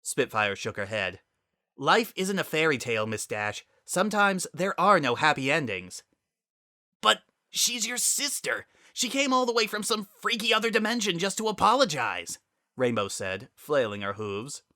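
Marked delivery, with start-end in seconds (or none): 1.8-6.0 a hint of a higher, bitter pilot voice; lightly voiced, scornful disbelief; bitter Spitfire. Spitfire scoffing at Rainbow's hopes, lightly voiced. 7.0-12.4 much higher, youthful pleading voice; pleading Rainbow. Acted. Rainbow pleading with Spitfire to forgive her sister, high.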